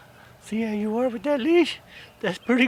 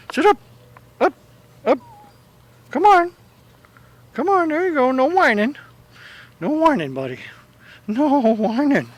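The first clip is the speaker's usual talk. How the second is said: higher, encouraging pet-talk; coaxing a dog; 6 semitones higher than usual. Real, not acted. Handler tells Jimmy no whining on the leash, coaxing. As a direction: coax the whining dog along in a raised voice.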